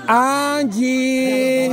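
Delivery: calling out. Real, not acted. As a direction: stretch the name out to reach her. Calls a player by name. (llamando en voz alargada)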